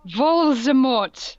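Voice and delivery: slow, loud, drawn-out name; booming slow reveal; dramatic reveal. Acted. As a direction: announce the villain with drama.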